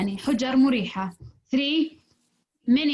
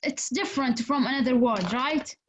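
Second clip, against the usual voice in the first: ordinary delivery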